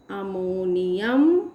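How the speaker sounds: Ammonium stretched slowly, low start rising at the end; drawn-out dictation. Real, not acted. Teacher writing the compound's name, drawn-out dictation. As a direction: draw out ammonium syllable by syllable, ending upward.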